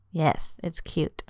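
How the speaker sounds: slow, tender "Yes, it's cute."; slow affectionate reply; tender boyfriend. Acted. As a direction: reassure her gently and slowly. Nick says it softly.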